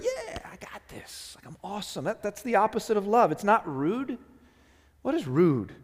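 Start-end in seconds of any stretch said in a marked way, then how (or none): 0.0-2.0 mock arrogance. An end zone dance in words, cocky gloating. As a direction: gloat like after a touchdown. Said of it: "love is not an end zone dance".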